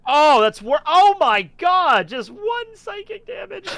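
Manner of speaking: high, drawn-out groan of disbelief; real dismayed outcry. Real, not acted. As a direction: wail at the pitiful roll. Reacts to a pitifully small damage roll.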